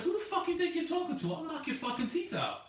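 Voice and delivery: high-pitched retold threat; flipping out. Driver retells threatening coworker, flipping out. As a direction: challenge him, pitch shooting up. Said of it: "I fucking flipped".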